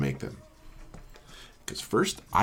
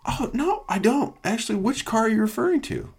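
much higher, falsetto innocence; mock innocent reply; 14 semitones higher than usual. Answering the warranty caller, in a high falsetto play-dumb voice. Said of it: "mock reply to caller".

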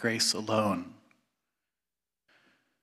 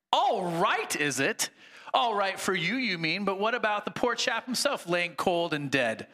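high, aggrieved complaining ghost; indignant ghost; 6 semitones higher than usual. Acted. Sermon reading, the big ghost protests to the bright spirit, high indignant voice. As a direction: whine it like a wronged tough.

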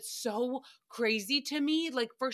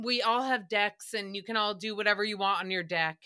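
usual speaking voice

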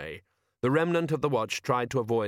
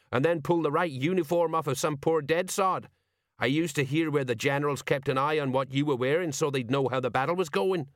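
put-on higher-pitched old soldier setting a mate straight; reproachful sergeant; 5 semitones higher than usual. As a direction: expose an old comrade's tricks.

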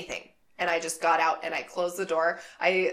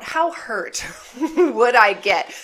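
laughing; 4 semitones higher, 8 dB louder, slower than usual. Real, not acted. Joking about jumping from car.

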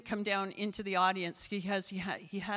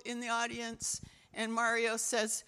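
normal talking voice

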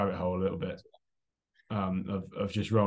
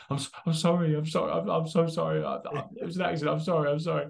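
frantic apology; 5 semitones higher than usual. Retold or imitated. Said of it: "he goes full on".

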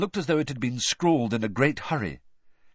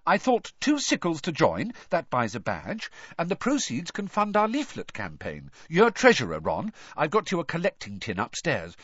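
higher-pitched, eager organising; happy; 7 semitones higher than usual. A narrator's reading. Hermione assigning roles to Ron and Harry, happily. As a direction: hand out the jobs happily. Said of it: "said Hermione happily".

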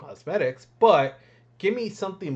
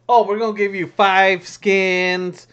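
mocking game company; 5 semitones higher, 9 dB louder, slower than usual. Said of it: "they're like".

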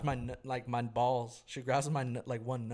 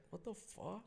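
soft, high retold disbelief; mock-baffled, high and under the voice; bewildered reaction; 7 semitones higher, 10 dB quieter, slower than usual. Retells his bewildered reaction.

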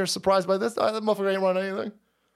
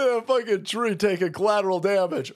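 ordinary delivery

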